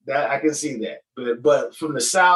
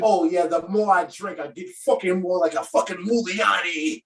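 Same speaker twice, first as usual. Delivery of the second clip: higher, hammed-up Boston tough talk; exaggerated Boston accent, pitched up; mock Boston accent; 4 semitones higher than usual. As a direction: caricature a boozy Bostonian. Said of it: "spoken like a true Boston motherfucker".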